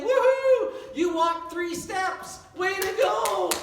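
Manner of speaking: loud high "Woohoo", then slow praise; cheering a baby who walked three steps, loud and high; delighted cheer. Retold or imitated. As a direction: whoop for the baby like a proud parent. Cheering baby's first steps.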